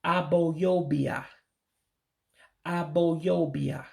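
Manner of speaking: Aboyobia said slowly, syllable by syllable, a-bo-yo-bi-a; slow, deliberate Aboyobia; slow Igbo word. Shown as a demonstration. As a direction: carefully sound out Aboyobia. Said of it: "it would be pronounced".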